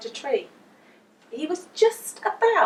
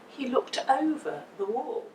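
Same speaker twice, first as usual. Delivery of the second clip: ordinary delivery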